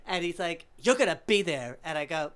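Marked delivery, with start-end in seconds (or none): 0.8-1.8 loud mock command from an old man; flamboyant insistence. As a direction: order them grandly, voice raised. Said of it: "so extravagant and flamboyant".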